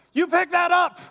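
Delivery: barking high, loud order; drill sergeant. Retold or imitated. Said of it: "like a drill sergeant".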